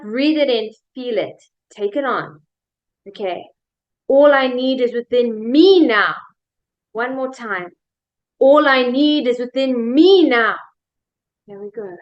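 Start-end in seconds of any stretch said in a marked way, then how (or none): 4.1-6.2 slow, powerful affirmation peaking on "me"; stress on ME. Shown as a demonstration. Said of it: "I'm gonna change the emphasis". 8.4-10.6 slow, powerful affirmation peaking on "me"; stress on ME. Shown as a demonstration. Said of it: "I'm gonna change the emphasis".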